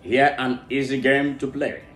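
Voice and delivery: ordinary talk, nothing marked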